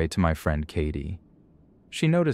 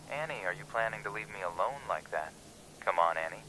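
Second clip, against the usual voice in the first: soft, eerie stalker whisper; creepy whisper; 3 semitones higher, 11 dB quieter than usual. Acted. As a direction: coax her in a hushed, creepy voice. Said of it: "A creepy voice whispered".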